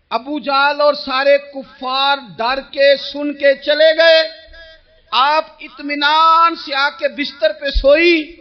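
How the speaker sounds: ordinary delivery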